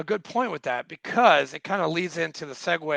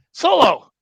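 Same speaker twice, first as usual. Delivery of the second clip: sharp call of the name, louder; 8 semitones higher, 8 dB louder than usual. Retold or imitated. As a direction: snap his name out in alarm. Calling out to his buddy.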